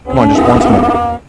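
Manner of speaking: high Wookiee howl over the words; Chewbacca roar. Acted. Host asks again and gets a high Wookiee howl. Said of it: "One more time".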